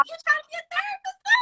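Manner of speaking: put-on high amused disbelief at her child. Therapist asks her daughter, high incredulous squeak. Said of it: "I'm like".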